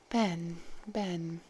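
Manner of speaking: quiet murmur to herself; under her breath. A narrator's reading. Gallia searching her memory, murmuring quietly. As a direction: turn the name over under your breath. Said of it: "repeated the name quietly under her breath".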